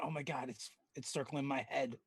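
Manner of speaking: lightly acted, under-the-voice wonder; mock awe. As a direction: play a softly spooked character. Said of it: "Huel might be walking around".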